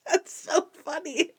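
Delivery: high laughter, slowed words; laughing. Real, not acted. Host laughing at Stottlemyre's French, real laughter. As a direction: laugh as you say it.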